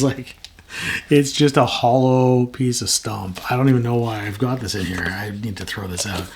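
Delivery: ordinary talk, nothing marked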